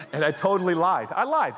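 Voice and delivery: ordinary talk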